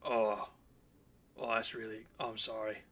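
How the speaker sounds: deflated, low apology; crestfallen. Acted. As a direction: sink into a sheepish sorry. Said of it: "puts his head down".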